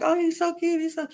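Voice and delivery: high-pitched mimicked swooning; hammed-up girlish gush; mock gushing. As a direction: imitate girls cooing over a new guy. Mimics girls gushing over newcomer.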